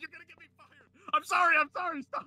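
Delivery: high, laughing panic; pleading through laughter to stop. Real, not acted.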